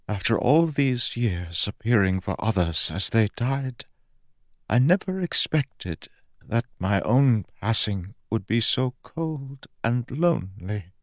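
a touch of a lonely dying god; mournful god. Acted. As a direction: let it fade to nothing.

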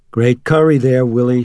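mother calling down to the cook from the stairs. A narrator's reading. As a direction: call it down the stairs, cheerful. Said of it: "she called down".

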